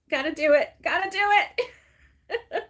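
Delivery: high, loud, drawn-out retort; playful mock stubbornness; gleeful insistence. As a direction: imitate someone cheerfully refusing to budge. Retells daughter's gleeful insistence.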